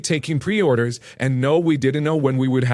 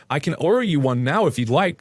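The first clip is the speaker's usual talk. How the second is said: ordinary delivery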